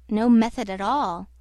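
usual speaking voice

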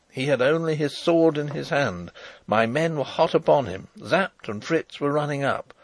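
ordinary delivery

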